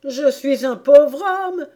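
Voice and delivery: high, loud, drawn-out plea; hard-pressed Hatter begging for pity; pleading Hatter. Acted. Hatter pleads with the angry King.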